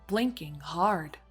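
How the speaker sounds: plain reading voice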